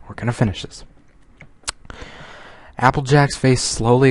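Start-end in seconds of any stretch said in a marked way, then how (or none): 0.1-0.8 softer grim resignation; quieter, resigned. Real, not acted. Reader tells listeners the story must be finished, softer and resigned.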